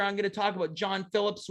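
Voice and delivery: ordinary delivery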